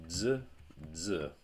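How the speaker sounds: z as "dzuh"; z begun with a d, buzzing; isolated z said as voiced dz; dz sound. Shown as a demonstration. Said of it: "So this is z".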